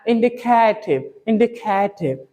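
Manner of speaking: इंडिकेटिव stretched slowly with an aspirated t; slow demo indicative. Shown as a demonstration. Teacher drills इंडिकेटिव slowly with the class. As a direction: model इंडिकेटिव slowly twice. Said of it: "क्या?".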